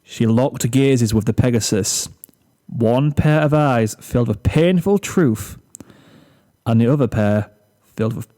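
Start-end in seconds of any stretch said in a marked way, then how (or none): none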